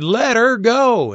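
slow, high, loud command; a healer insisting on his command; commanding order. Retold or imitated. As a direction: order it slowly and firmly.

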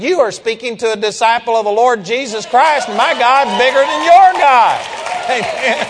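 re-enacted shout, rising to a triumphant peak; retold yell. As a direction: yell it back in his face, climbing to the end. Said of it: "I yelled at him and I said".

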